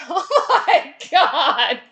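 slow, loud, high exclamation through laughter; aghast. Retold or imitated. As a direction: draw it out in mock horror. Aghast reaction to grandpa's fit.

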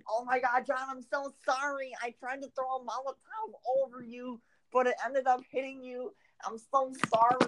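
high, frantic apologetic voice throughout; mock frantic apology. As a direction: imitate your friend apologizing in a panic. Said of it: "And you're like".

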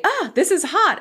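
high, startled "Ah, this is hot"; mock frog yelp. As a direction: squeak it out like a scalded frog. Frog imitated jumping out.

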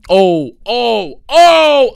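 oh climbing to a high loud cry; rising excited oh; excited yelp. Real, not acted. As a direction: burst out suddenly. Sudden outburst while recording.

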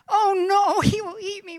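high, loud panicked shout; panicked cry. Acted. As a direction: cry out in terror at the shark. Jonah sees a shark.

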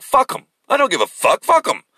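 high-pitched, imitated defiance; defiant outburst. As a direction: throw it out as someone else's brash retort. Quotes De Niro's defiant retort.

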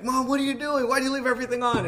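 high, loud mock scolding of mom; retold angry shout. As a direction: shout like an upset child. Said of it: "I kinda shout at her".

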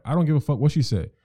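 imitating a careless boyfriend; dismissive guy. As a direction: brush her off with contempt. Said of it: "where they're like".

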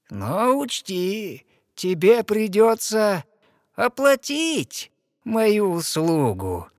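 high, slow, otherworldly witch voice; drawn-out sorceress setting her price; sea witch. Acted. The sea witch tells the princess her price, high and slow.

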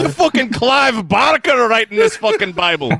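put-on angry God in a Scottish accent. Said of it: "fun name Clive Barker is to say with a Scottish accent".

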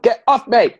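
high, loud recoiling yell; mock protest shout. Owner to Mary after asking for a cuddle, mock protest shout. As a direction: yell it like someone squirming out of a hug.

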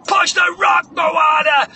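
loud, high trainer's yell; hammed-up montage coach hollering; shouted urging. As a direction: drive the athlete on at full shout. Trainer urging Moana to push.

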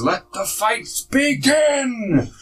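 high, slow booming announcer. Acted. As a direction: draw it out like an arena announcer. Dwarf announcer opens the fights.

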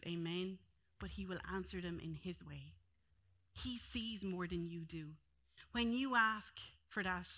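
calm the congregation gently; quiet tender preaching close; gentle reassurance. Acted. Soft closing reassurance.

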